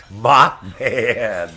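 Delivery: Mothman called out loud, falling; punchline. Real, not acted. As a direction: call out Mothman as a joke. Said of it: "naming the bug joke".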